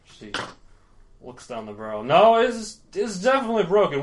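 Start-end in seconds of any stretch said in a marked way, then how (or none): 2.0-4.0 higher, louder, fast insisting; loud, excited insistence. Acted. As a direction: insist it's broken. Drunk NPC insists, excited.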